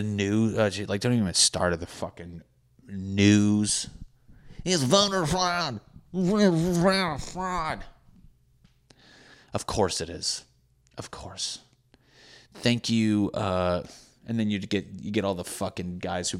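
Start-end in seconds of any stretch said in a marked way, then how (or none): 4.6-7.8 much higher, slow put-on voice crying voter fraud; mock outraged pundit. Mocking news outrage. 11.0-11.6 breathy whispered Of course, much softer; whispered aside. Real, not acted. Sarcastic agreement then whispered repeat.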